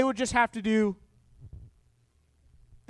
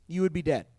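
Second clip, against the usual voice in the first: usual speaking voice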